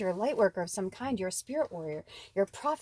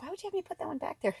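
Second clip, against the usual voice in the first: puzzled quip; 7 semitones higher, 6 dB quieter, much faster than usual. Retold or imitated. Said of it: "I was like".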